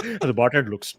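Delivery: ordinary talk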